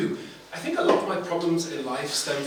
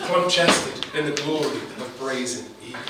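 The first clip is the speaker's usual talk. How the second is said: usual speaking voice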